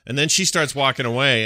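ordinary talk, nothing marked

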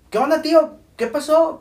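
high, loud phone hello; acted phone greeting. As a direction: imitate calling out to an uncle on the phone. Brother answers uncle's phone call.

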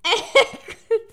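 high, slow, laughing through it; can't keep a straight face, laugh it out. Real, not acted. Reader losing it to laughter while voicing Matushka.

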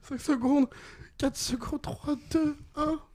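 very high, put-on timer voice; mock countdown voice. Quizmaster warns players time runs out, high-pitched countdown. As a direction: count down in a squeaky-high register.

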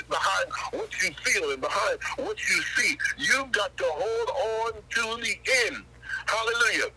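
rising pulpit exhortation, driving them to the finish; preaching at full force. Acted. Preacher urging congregation near the close.

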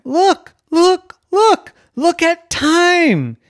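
high, loud, urgent shouts; coach shouting. Acted. Coach shouts that a player is open, urgent shouting. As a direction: shout urgently, drawing out the last word. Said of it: "the coach started shouting".

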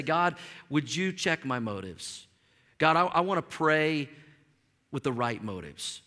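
low, soft, unhurried praying; humble prayer. Shown as a demonstration. Preacher showing how to ask God, humble and hushed.

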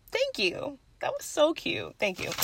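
very soft, high grateful coo; touched thanks. Retold or imitated. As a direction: thank them in a tiny touched voice. Retells thanking for compliment.

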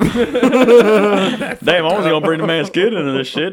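high laughing denial after a harsh joke; laughing protest. Real, not acted. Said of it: "That's fucked up".

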